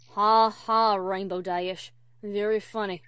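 low, dry, unamused sarcasm; deadpan. Acted. Applejack unimpressed by Dash's teasing, deadpan. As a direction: fake laugh in a flat country voice, loud start.

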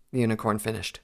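usual speaking voice

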